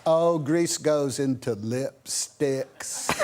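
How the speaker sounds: drawn-out put-on Mississippi accent. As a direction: stretch every word in a mimicked Mississippi boy's accent. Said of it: "deep in Mississippi".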